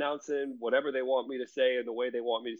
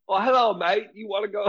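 higher, badly faked London accent; mock London accent; 5 semitones higher than usual. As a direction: imitate a friend faking a London accent. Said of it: "trying to do this London accent".

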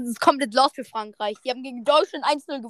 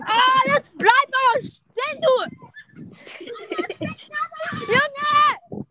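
shouting at him to stop; 11 semitones higher, much slower than usual. Real, not acted. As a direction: yell after someone running off.

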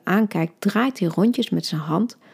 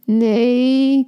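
a touch of laughing protest, higher and louder; groaning laugh; 6 semitones higher, 5 dB louder than usual. Acted. Boyfriend protesting her joke, laughing groan. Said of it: "kreunt hij lachend".

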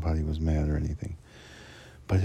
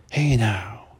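a loud, higher, jumpy "Hey now"; sudden loud greeting; 5 semitones higher, 5 dB louder than usual. Retold or imitated. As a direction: call it out to startle someone. Coworker startles him at elevator.

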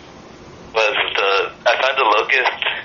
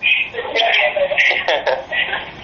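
high laugh. Real, not acted. Laugh in aside.

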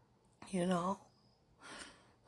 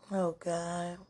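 ordinary talk, nothing marked

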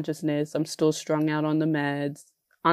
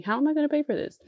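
panicked; 10 semitones higher, much faster than usual. Retold or imitated. Retold panic over cost.

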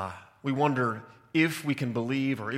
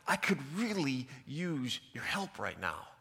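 softer, let-down repeat of the plea; quiet plea; 5 dB quieter than usual. Retold or imitated. Retelling, asking the grinning teacher again, softer and deflated.

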